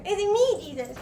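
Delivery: high mimicking echo of the question; Jesus mocking back. Jesus echoing Judas in a high mocking voice. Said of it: "And he's like".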